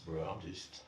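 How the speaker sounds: a low, hushed, half-mumbled bro impression; imitating his friend. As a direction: drop low and mumble it like a laid-back buddy. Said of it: "He can tell me like".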